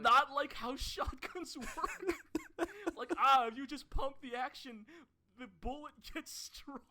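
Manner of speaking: high, loud words through real laughter; laughing. Real, not acted. Laughing at a silly scene.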